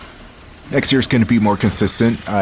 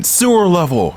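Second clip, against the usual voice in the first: high, emphatic exclamation; mock insistence; 8 semitones higher than usual. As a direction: blurt it out as a mock developer pitching an idea. Mock developer insisting on the idea.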